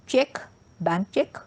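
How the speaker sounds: normal talking voice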